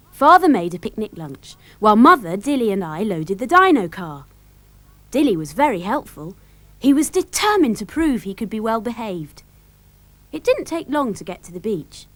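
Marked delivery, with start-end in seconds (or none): none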